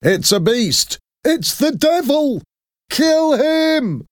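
angry mob screaming for blood; mob shout. A narrator's reading. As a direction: whip the crowd into a killing rage. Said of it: "started to shout".

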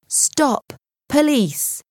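high commanding shout. A narrator's reading. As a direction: bark the order to stop. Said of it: "a policeman shouted".

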